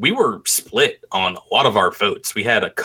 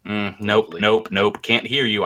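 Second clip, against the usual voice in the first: usual speaking voice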